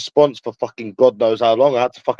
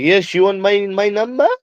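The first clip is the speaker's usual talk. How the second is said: high-pitched put-on French accent, climbing at the end; little French accent, pitched way up; mock French accent; 9 semitones higher than usual. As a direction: mimic them in a high French voice. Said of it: "In their little French accent".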